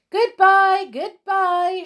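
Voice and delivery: high, loud singing-out farewell; gleeful shout. A narrator's reading. Brownies waving to the crowd, delighted shouts. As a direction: call goodbye in delight. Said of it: "shouted the brownies".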